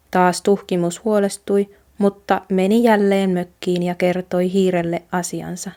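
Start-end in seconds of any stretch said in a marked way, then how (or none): none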